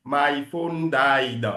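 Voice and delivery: slow African-accented "My phone died". Shown as a demonstration. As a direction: mimic an African English accent, drawn out. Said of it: "faut pas parler comme un Africain". (accent africain imité, lent)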